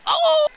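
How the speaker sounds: high, loud "oh" of shock; startled shout. Real, not acted. Commentator reacting as Amy has that shot, startled yell. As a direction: yelp out at the shot.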